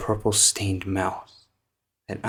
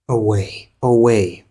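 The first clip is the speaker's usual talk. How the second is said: "away" called out, louder the second time; building exclamation; 7 dB louder than usual. Acted. Poet bidding the nightingale away, rising exclamation. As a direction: cry it out, building on the repeat. Poem's speaker cries out to flee.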